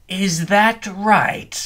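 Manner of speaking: the vampire mocks the clown, slow and sarcastic. A narrator's reading. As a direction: drip sarcasm on every word.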